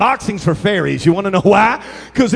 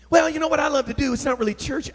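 higher, softer mock voice; meek answerer; 6 semitones higher, 5 dB quieter, faster than usual. Church member admitting an unchurchy passion, sheepish mock voice. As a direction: imitate a sheepish excuse, getting quieter.

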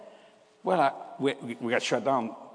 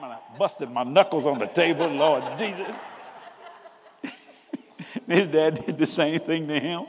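normal talking voice

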